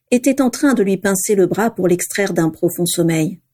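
ordinary delivery, nothing marked

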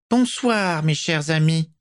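a sweet-voiced villain, high and sibilant; soft high hissing voice. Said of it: "de sa voix doucereuse, aiguë et sifflante".

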